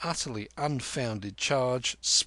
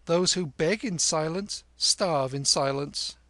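put-on higher, knowing boy reciting a saying; boy quoting a proverb; 5 semitones higher, 4 dB louder than usual. A narrator's reading. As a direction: recite it like a street-smart boy. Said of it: "quoting a native proverb".

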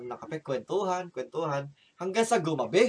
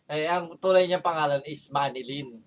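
ordinary delivery

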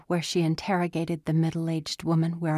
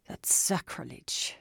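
hushed whisper; 4 semitones lower, 5 dB quieter than usual. Acted. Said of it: "Sal said".

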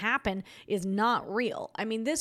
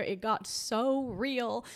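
ordinary talk, nothing marked

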